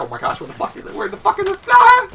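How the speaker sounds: hushed start jumping to a high squeal; mock panic. Gamer recalls hunting the fly, mock panic. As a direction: reenact frantic searching, pitch leaping up. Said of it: "having that O D panic, head panic looking like".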